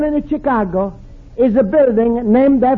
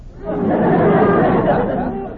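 chuckle; 9 semitones higher than usual. Acted. Luigi chuckles at his own joke.

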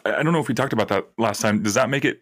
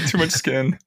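laughing and higher through it; 6 semitones higher than usual. Real, not acted. Friend repeats the joke through a laugh. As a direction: giggle the words out.

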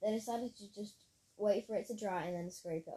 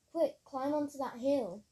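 urgent shout, higher and louder; 6 semitones higher, 6 dB louder than usual. A narrator's reading. Tamara urges friends out of the falling forest, shouting. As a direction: shout a slow, urgent order. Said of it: "shouted Tamara".

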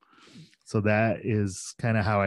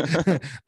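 short laugh; 8 semitones higher than usual. Real, not acted. Laughing at own story.

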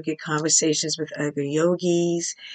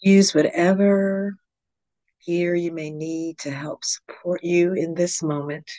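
calm, measured meditation guide with long pauses; guided yoga nidra. Real, not acted. Yoga teacher guiding listeners into yogic sleep, calm meditation voice.

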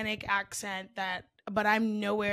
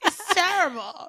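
"terrible" drawn out slowly and higher, Jersey style; elongated-vowel accent; 7 semitones higher, 5 dB louder, slower than usual. Shown as a demonstration. Co-host showing off the accent, elongated Jersey vowels. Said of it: "Some vowels are just elongated".

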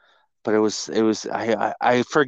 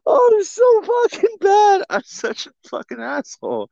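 high-pitched laughing through the words; cackling at himself; 4 dB louder than usual. Real, not acted. Cracking up at own prank.

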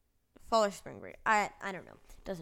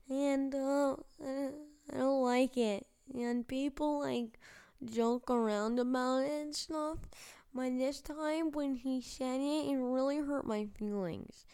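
high, upset third grader voice; mock-crying kid; 6 semitones higher than usual. Third grader saying it hurt their feelings, high tearful voice. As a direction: imitate a little kid close to tears. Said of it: "I was, like, crying".

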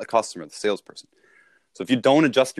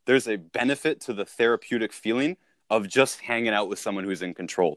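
usual speaking voice

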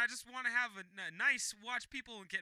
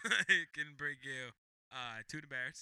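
slow, lower faked lady voice; congested neutral voice; 7 semitones lower than usual. Offering food to the co-host, congested put-on voice. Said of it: "just congested voices".

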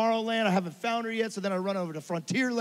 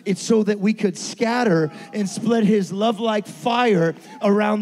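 loud, rising sermon delivery; preaching at full force; 9 dB louder than usual. Acted. Pastor urging the church to scatter, loud preaching. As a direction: drive it home to the congregation.

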